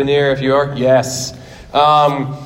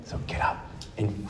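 barely audible whisper; whispered exhortation; 5 semitones lower, 16 dB quieter than usual. Real, not acted. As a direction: whisper the urging, holding back. Hushed exhortation before final shout.

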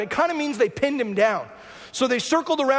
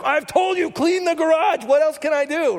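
higher and louder, fed up; exasperated mom; 5 semitones higher, 4 dB louder than usual. Retold or imitated. A mother fed up with her kids, higher and louder. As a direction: throw up your hands at the kids. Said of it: "And the moms are like".

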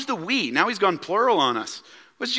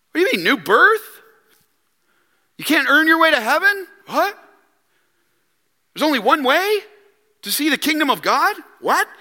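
higher-pitched mock disbelief, rising at the ends; incredulous questioning; 5 semitones higher than usual. Learned teacher hears of new birth for the first time, incredulous. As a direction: act out a baffled listener, question after question.

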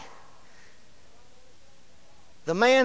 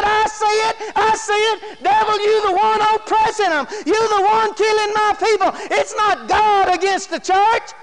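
high-pitched preacher confronting the devil; acting out a pastor's fervent realization; fervent revelation; 8 semitones higher than usual. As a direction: declare it urgently, voice pitched up.

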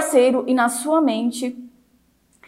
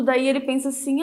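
ordinary talk, nothing marked

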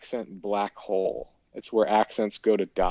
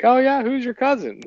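imitating an eager, high-pitched questioner; eager curiosity; 13 semitones higher, 5 dB louder than usual. Business contact asking about a fellow fan's cousin, mock-eager. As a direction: pitch it way up, mimicking keen interest.

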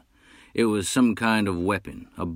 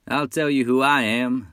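higher, louder bold hunter; 7 semitones higher, 6 dB louder than usual. Acted. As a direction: call out like a proud hunter. Hunter speaks in character.

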